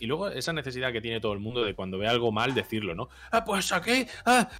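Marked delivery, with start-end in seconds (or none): 3.3-4.5 high-pitched mock exclamation; mocking fault-finder. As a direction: imitate someone pointing out the obvious, voice way up. Mimics people who must point faults out.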